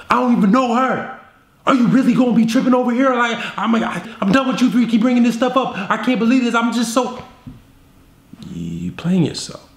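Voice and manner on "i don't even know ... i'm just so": frantic overreaction. Retold or imitated. Boyfriend to girlfriend, high fast frantic denial. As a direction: babble defensively, rushed. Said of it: "If you're over here like".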